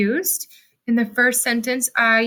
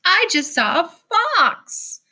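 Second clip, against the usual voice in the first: emphatic, higher-pitched demo of excitement; excited exclamation; 7 semitones higher than usual. Shown as a demonstration. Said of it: "read with a little bit of emphasis".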